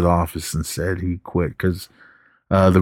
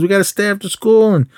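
high-pitched imitated griping; mock complaining; 11 semitones higher than usual. Students object to practice tests, mock griping. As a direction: moan it like put-upon students. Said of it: "they're all bitching and complaining".

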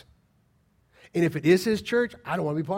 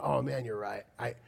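lower, acted sheepish admission; oh-you've-got-me, said low; humble concession; 5 semitones lower than usual. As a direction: imitate someone humbly conceding a point. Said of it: "they're humble enough going".

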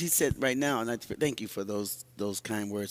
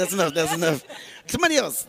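louder and higher, laughing it off; laughing cut-off; 6 semitones higher, 7 dB louder, faster than usual. Real, not acted. Pastor jokingly cutting off her age count, loud. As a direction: stop her with a laughing shout.

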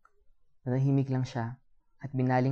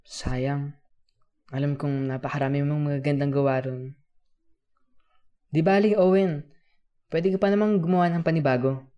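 louder, lifting kuya voice; encouraging, raised voice of an older friend; comforting encouragement; 2 semitones higher, 8 dB louder than usual. A narrator's reading. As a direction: cheer up a downhearted child, pitch rising as you go. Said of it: "Sinubukan ko magsabi ng ikagagaan ng loob niya".

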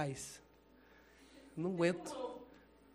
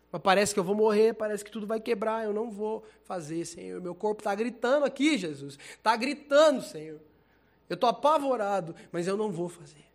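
loud, high, desperate pleading prayer; anguished prayer; 5 semitones higher, 8 dB louder than usual. Retold or imitated. Praying to the Lord while terrified, loud desperate plea. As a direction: cry to the Lord while holding firm.

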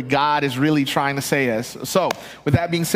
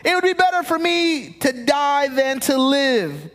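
despairing Jonah; 8 semitones higher than usual. Acted. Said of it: "He wanted to die and said".

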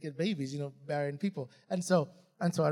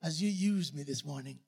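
softer, low murmured prayer; hushed humble prayer; 5 semitones lower, 5 dB quieter than usual. Retold or imitated. He recalls praying this morning before preaching, hushed and humble. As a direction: pray quietly with humility. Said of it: "Today, I said that".

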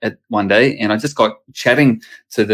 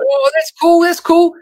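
put-on high, bright-eyed kids gushing about the news; excited kids; 3 dB louder, slower than usual. Kids reacting to dad's new chaplain job, high and excited. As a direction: squeal it like his excited children. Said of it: "And they're like".

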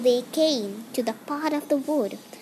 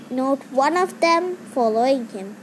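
ordinary delivery, nothing marked

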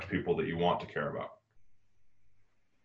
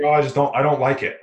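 higher, louder mock whine; imitating the client's sister; 7 semitones higher, 6 dB louder than usual. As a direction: pitch her protest up like a sulky complaint.

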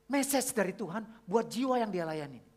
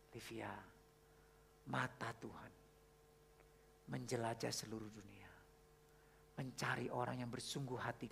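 low, hushed, unhurried fatherly comfort; tender reassurance; 8 semitones lower, 12 dB quieter than usual. Retold or imitated. Father consoling his lonely daughter with God's promise, low and tender. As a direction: reassure very softly, dropping near a whisper at times.

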